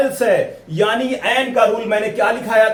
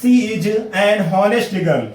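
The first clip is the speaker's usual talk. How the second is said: slow, careful English read-out; slow dictation; much slower than usual. Shown as a demonstration. Teacher dictating the example sentence slowly to students. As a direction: spell out each word for the class. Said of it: "यानी कह रहा है".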